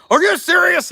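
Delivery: high, loud mock indignant "Are you serious?"; mock indignant boss. Vlogger imagining his boss lady's reaction, high mock indignation. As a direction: play the outraged boss lady. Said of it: "she'd have been like".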